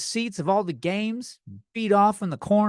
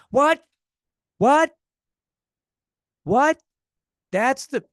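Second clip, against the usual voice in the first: loud, high, disbelieving what; stunned outburst at a sick plot twist; shocked shout; 7 semitones higher, 6 dB louder, slower than usual. Real, not acted. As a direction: yell it like you can't believe what you just heard.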